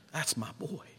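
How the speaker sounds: soft, quiet and tender with a lift at the end; tender father. Retold or imitated. Prodigal's father forgiving his son, soft and tender. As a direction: murmur it with gentle love. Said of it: "the father of that prodigal says".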